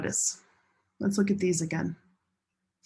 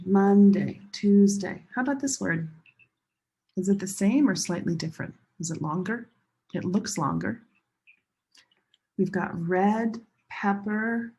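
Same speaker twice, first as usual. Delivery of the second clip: usual speaking voice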